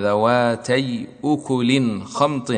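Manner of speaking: slow drawn-out recitation of the verse; Quranic recitation. Shown as a demonstration. Teacher reciting the verse before explaining it. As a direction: chant it slowly, letting the words stretch. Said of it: "كذلك قوله تعالى".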